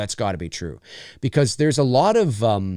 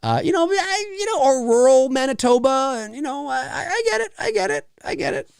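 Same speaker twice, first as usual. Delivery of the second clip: much higher, put-on rural Canadian voice; mock rural prairie accent; 12 semitones higher than usual. Imitating rural Saskatchewan folk, high put-on rural accent. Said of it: "might sound a little bit like this".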